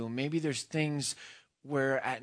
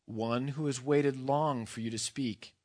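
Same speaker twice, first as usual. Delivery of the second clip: ordinary delivery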